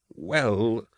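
soft, hesitant "well"; stammered. A narrator's reading. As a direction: falter uneasily and quietly. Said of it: "Carl stammered".

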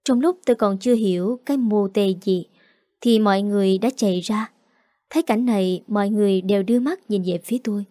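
usual speaking voice

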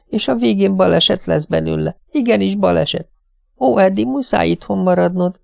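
high, plaintive wail; wailing wife, pitched high and pleading. A narrator's reading. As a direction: whimper it like a helpless wife. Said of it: "siránkozott az asszony".

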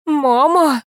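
frightened yell for help. A narrator's reading. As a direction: cry out Mama in alarm. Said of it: "заорала я".

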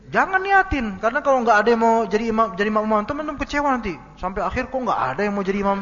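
preaching at full force; emphatic warning. Real, not acted. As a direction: press the warning home.

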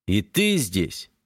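slow, sullen question; gloomy. Acted. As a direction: drag it out, scowling. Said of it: "Хмуро бросаю".

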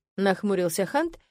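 ordinary delivery

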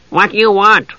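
high, odd little creature voice; gully dwarf voice. A narrator's reading. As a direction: squeak it out from the dark.

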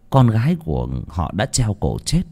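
plain reading voice

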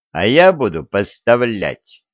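ordinary delivery

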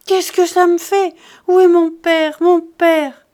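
loud, high wail building in grief; anguished sobbing cry. Acted. Charles wailing to his uncle over his dead father. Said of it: "Les pleurs et les sanglots".

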